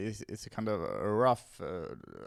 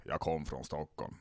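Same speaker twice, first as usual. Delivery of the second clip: lower, softer non-attempt at Stockholm Swedish; half-hearted Stockholm accent; badly faked Stockholm dialect; 5 semitones lower, 6 dB quieter than usual. Comic mocking his own dialect skills, half-hearted Stockholm accent. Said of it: "säg en dialekt jag ska härma".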